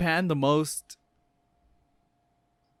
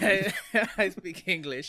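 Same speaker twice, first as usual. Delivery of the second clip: put-on slightly higher, joking French accent; mock French accent; 6 semitones higher than usual. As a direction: imitate a French person speaking English. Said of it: "that's a horrible French accent".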